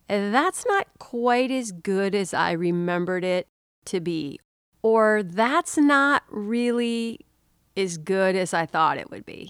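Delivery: slow, drawn-out verdict; hesitant letdown. Retold or imitated. Eater judging long-craved food after tasting it, hesitant letdown. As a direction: stretch it out as if reluctantly admitting disappointment. Food falls short of craving.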